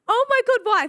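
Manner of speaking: high, loud alarmed question. Retold or imitated. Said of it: "I'm like".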